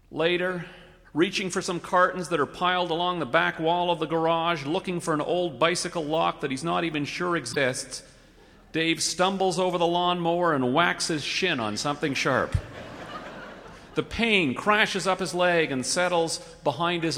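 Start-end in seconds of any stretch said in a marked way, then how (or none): none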